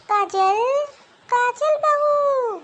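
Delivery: loud, high call with the name drawn out. Acted. Mother-in-law summons her daughter-in-law, calling out. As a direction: summon someone from another room, stretching the last word.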